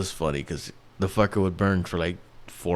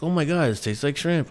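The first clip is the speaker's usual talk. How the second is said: higher, giddy delighted gasp; gushing TV host; 6 semitones higher than usual. Retold or imitated. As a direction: play it as an over-excited host. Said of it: "Ryan Seacrest is like".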